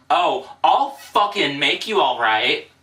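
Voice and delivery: loud, slow threatening snarl; menacing threat. Acted. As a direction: promise her a beating. Threatening the alter ego.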